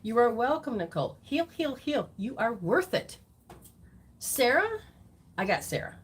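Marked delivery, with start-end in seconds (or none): none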